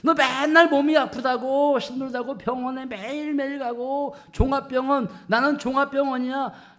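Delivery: high-pitched, needling jeer; mocking taunt. Scoffer taunting a believer who is always ill, mocking. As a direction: imitate a scoffer piling on questions. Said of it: "조롱하고 비아냥거리는 거예요".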